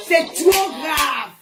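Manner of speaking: milk it for drama; hammed-up grave warning; very slow dramatic. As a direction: stretch every word out very slowly.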